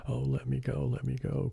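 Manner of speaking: high, frightened little bear crying to be let go; a cub's scared plea, pitched well up. A narrator's reading. Little bear caught by the alligator, crying out high in fright. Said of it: "cried Neddy".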